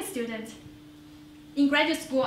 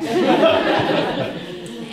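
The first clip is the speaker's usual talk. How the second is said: big laugh, higher and louder; 5 semitones higher, 9 dB louder than usual. Real, not acted. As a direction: burst into laughter. Laughing at mother's worry.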